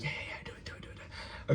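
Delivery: hushed, whispered egging-on; urging whisper. Retold or imitated. Friend egging him on by the shelves, urgent whisper. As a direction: whisper it like an accomplice.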